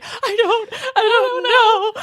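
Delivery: high, drawn-out hammed-up wail; mock sobbing. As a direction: imitate yourself wailing through sobs. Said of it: "would start sobbing".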